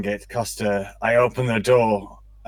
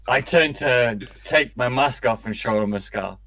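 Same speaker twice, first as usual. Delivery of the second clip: ordinary talk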